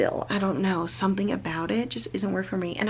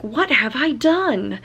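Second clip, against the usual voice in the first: drawn-out, higher and louder melodramatic quote; mock-dramatic dismay; 5 semitones higher, 5 dB louder, slower than usual. Reader quoting a regretful book character, mock-dramatic dismay. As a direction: imitate an overwrought romance hero.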